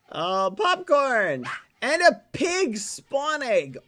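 loud, high, drawn-out excitement; excited delight. Real, not acted. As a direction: stretch the words out, thrilled by the gift. Delighted by game gifts.

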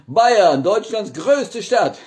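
high, loud shout. Acted. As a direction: yell it out. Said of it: "warum ich so schreie immer".

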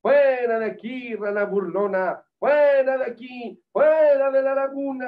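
loud, high-pitched character shout; worm's shouts. A narrator's reading. Worm ordering the mocking frog out of the pond, shouting. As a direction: shout it out as a storybook worm chasing someone off. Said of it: "Con los gritos del gusano".

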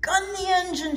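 high, excited shout; kids shouting. A narrator's reading. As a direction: cheer her on. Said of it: "shouted the kids".

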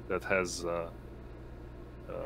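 ordinary delivery

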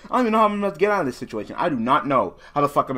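fast, loud and high, words tumbling out; panicked rant. Real, not acted. Player sure he's losing the duel, fast panicked rant. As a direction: rush it in a panic.

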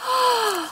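high, sharp awed gasp; breathless thrilled gasp. Real, not acted. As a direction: gasp at a rare pull. Rare card revealed.

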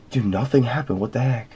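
ordinary delivery

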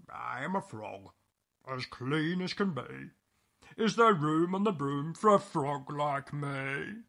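croaky storybook frog voice; croaking frog. Acted. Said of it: "then said with a croak".

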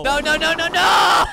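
high, loud rising panic; panicked shout. Real, not acted. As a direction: scream no over and over. Panics, can't swim.

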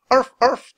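high yappy arf, arf; dog bark. Acted. Teddy the dog barking at monkeys, yappy bark. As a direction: bark like a small excited puppy. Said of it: "Teddy barked".

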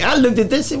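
ordinary delivery

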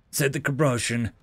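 usual speaking voice

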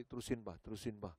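very soft pleading murmur; whispered inner plea. Retold or imitated. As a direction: barely breathe it like a silent plea.

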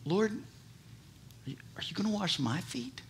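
soft, humble wondering question; soft humble Peter asking; humbly inquisitive. Retold or imitated. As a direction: ask it humbly, almost hushed. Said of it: "in an inquisitive way, humbly".

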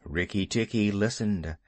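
ordinary delivery

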